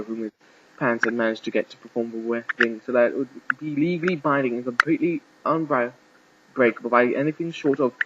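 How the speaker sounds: plain reading voice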